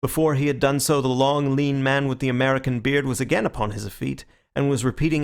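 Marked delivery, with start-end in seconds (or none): none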